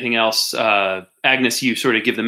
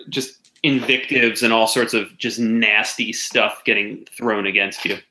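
ordinary talk, nothing marked

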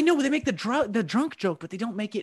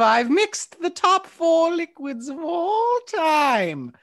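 witch voice; 6 semitones higher, much slower than usual. Acted. Witch persona continues.

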